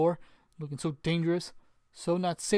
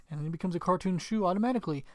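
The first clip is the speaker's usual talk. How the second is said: ordinary delivery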